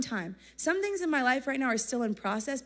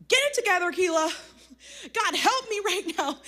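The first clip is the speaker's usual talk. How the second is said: higher and louder, telling herself off; self-scolding; 7 semitones higher, 4 dB louder than usual. Retold or imitated. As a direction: snap yourself out of it, then plead. Scolding herself to fix attitude.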